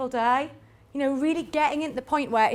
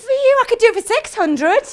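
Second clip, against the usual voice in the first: higher, louder, pleading offer; desperate discount; 9 semitones higher, 7 dB louder than usual. Shown as a demonstration. Seller caving to the buyer, desperate and loud. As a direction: panic and cave on price. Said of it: "And then you go".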